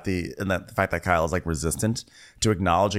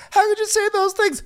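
very high, singsong mock wail; hammed-up high-pitched hysterics; imitating Kyle; 3 dB louder than usual. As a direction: squeal it like a musical refrain. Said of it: "musical moment where Kyle goes".

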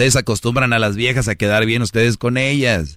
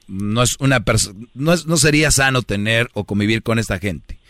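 ordinary talk

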